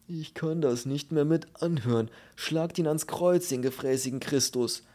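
furious, exasperated tirade; raging. Acted. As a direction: rant as if you cannot bear it. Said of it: "tobte das Huhn im Koffer".

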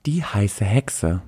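drawn-out, slow announcement of the pick; slow countdown reveal. Acted. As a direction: unveil it slowly like a countdown host. Announcing the number two pick.

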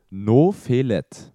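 "Nofelet" repeated slowly and loudly, syllable by syllable; backwards speech. Shown as a demonstration. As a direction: spell out "Nofelet" slow and loud again. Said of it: "Wie nochmal?". (rückwärts gesprochen: "Nofelet", langsam)

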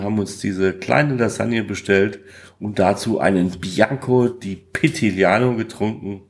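ordinary talk, nothing marked

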